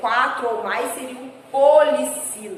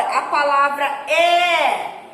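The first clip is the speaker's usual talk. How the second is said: é drawn out slowly and loud; stressed é; 4 dB louder, much slower than usual. Shown as a demonstration. Teacher showing é is stressed to pupils, slow and loud. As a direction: lean hard on the word é. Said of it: "também é uma sílaba tônica".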